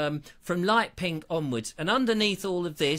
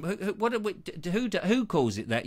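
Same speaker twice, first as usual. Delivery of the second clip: usual speaking voice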